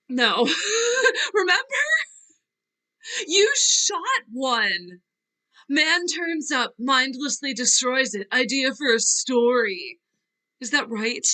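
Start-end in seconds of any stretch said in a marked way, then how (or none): 0.1-5.0 high, tearful laugh; laughing through tears. Acted. Actress laughing and weeping at old memory, tearful. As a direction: laugh while crying.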